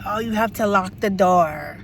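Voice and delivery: slow, bossy put-on voice; mocking the supervisor. Mimicking the supervisor's order to lock up, slow mocking voice. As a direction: order her around like a smug boss. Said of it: "Then she's like".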